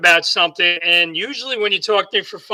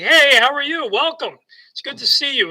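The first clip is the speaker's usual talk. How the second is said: lifted, bright-toned greeter voice, much higher; imitating a cheery greeting; 8 semitones higher than usual. Speaker imitates the friendly restaurant worker greeting him with a lifted voice. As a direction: play the friendly guy saying hello.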